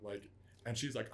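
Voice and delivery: normal talking voice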